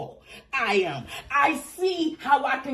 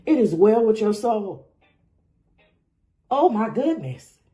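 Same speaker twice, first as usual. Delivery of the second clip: usual speaking voice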